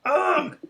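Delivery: high-pitched "Ugh!" cry; acted agonized grunt. Game master voices what was heard from Ender, crying out. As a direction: let out a sudden pained cry. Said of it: "All you heard was".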